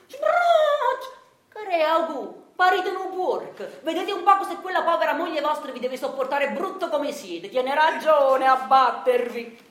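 loud, high teasing call to his friend; mocking tease. Teasing Sbraggia about his ugliness and his wife, loud mocking call. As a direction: rib him loudly, half laughing at him.

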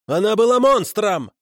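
очень высокий громкий крик; кричит в ярости; выкрик. Acted. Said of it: "выкрикнул Макар". (Макар выкрикивает, очень высоко и громко)

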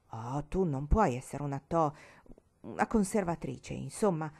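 ordinary delivery

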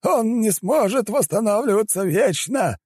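very high, strained, drawn-out shout; frantic angry screaming; elder's furious yelling. A narrator's reading. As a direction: bellow orders at useless underlings. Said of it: "орал старейшина".

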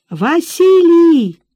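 frantic scream. Acted. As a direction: scream the name from a window above. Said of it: "Истошный женский крик".

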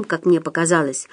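ordinary delivery

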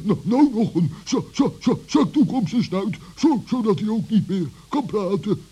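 high, giggly stammering over the first syllables; excited stammer. Acted. Mole to Paulus, stammering with high, giggly delight. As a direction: trip over the words in glee.

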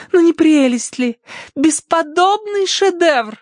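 put-on sky-high girlish gushing; gushing rapture. A narrator's reading. Tossie showing the stump to the butler, rapturous. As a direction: gush in a squeaky voice, stretching the praise. Tossie gushes over the sculpture.

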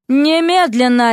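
high, drawn-out command; sharp, insistent yell; barked order. A narrator's reading. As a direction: bark it up from below. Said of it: "рявкнул снизу Эрик".

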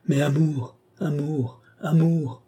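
high, seductive, rising repetitions; intoxicating voice. A narrator's reading. Lorenza seducing Balsamo to yield, high enchanting voice. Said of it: "de sa voix enivrante".

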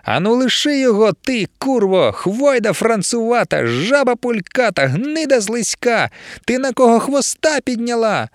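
high, slow shrieking insults; jealous Emilia screams abuse at the snake; furious yelling. Acted. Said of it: "бо знову закричала".

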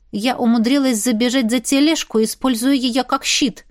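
usual speaking voice

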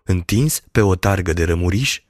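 ordinary delivery

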